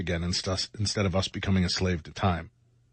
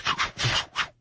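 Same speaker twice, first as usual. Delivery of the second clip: airy blown whooshes of passing cars; imitated whoosh. Driver retelling a spin-out on the highway, imitated car whooshes. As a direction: blow quick puffs for cars whipping past.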